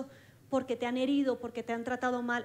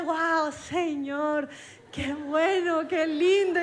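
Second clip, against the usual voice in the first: louder and higher, pleased with herself; smug delight; 3 semitones higher, 6 dB louder, much slower than usual. Retold or imitated. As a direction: gush as if proud of a good deed. Smug self-praise after preaching.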